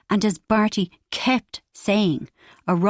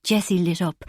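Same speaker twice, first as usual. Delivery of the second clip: ordinary delivery, nothing marked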